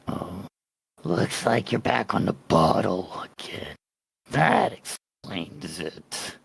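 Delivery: low, slow, sneering creature voice; towering creature mocking a drunk player character; weird monster voice. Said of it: "Your voice sounds weird".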